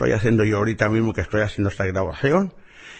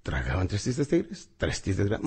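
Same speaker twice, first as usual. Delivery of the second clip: quiet repetition; 5 semitones lower, 7 dB quieter than usual. Shown as a demonstration. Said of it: "Repítelo ahorita mismo".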